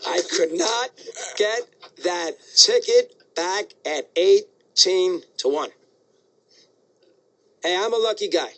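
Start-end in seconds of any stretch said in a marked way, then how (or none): 0.1-5.7 slow, each word set apart by pauses; emphatic word-by-word. Real, not acted. Host telling how he failed to retrieve the ticket, emphatic, word-by-word. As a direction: spell out the punchline word by word. Exasperated punchline of ticket story.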